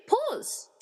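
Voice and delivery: high, snapped command; commanding remote click. Acted. Tina freezes the army with the remote, snapped command. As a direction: snap the word like pushing a button.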